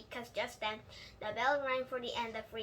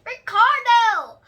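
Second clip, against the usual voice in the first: very high, loud, slow holler; 10 semitones higher, 19 dB louder, much slower than usual. Acted. Junie hollers at her boyfriend across the playground. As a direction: yell his name across the playground. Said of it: "I hollered real loud".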